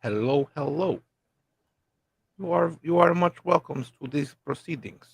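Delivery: thick mock Russian accent; hammy Russian accent. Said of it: "As I try out my Russian accent".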